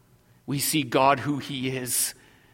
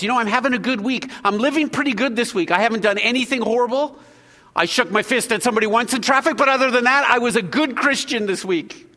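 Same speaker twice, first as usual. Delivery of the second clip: quick, higher, self-satisfied churchgoer voice; smug self-congratulation; 6 semitones higher, 4 dB louder, faster than usual. Retold or imitated. Preacher mocking a smug churchgoer, lightly voiced, higher and fast. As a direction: brag lightly about a good week. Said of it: "we kind of congratulate ourselves".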